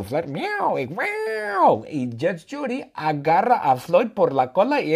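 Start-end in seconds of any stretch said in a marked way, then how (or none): 0.2-0.8 higher imitated meow; cat meow. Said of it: "Floyd es un gato". 0.9-1.8 very high, drawn-out yowl; cat meow. Retold or imitated. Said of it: "Floyd es un gato".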